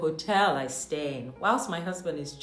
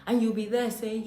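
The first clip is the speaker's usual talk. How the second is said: usual speaking voice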